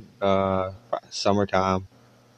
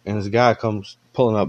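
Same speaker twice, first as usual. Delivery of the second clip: ordinary delivery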